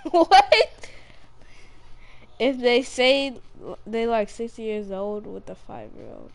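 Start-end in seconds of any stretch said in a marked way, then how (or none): 0.1-0.9 high, loud disbelieving what; sudden incredulous squawk; shocked what. Real, not acted. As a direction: yelp it in outrage. Reacts to being called predator.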